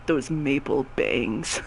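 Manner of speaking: very low, slow, soft impersonation; creepy low voice. Retold or imitated. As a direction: drop low and draw it out suggestively. Low mocking voice, same as before.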